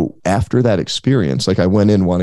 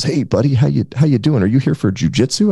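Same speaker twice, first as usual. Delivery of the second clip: higher, friendly mock voice; imitating Noah; 6 semitones higher than usual. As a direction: greet him like a cheery gym buddy. Noah greeting him at gym.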